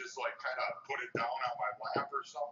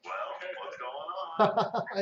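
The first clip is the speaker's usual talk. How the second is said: wife's voice; 6 semitones higher, 7 dB louder, much slower than usual. Retold or imitated. Wife quoted checking on him.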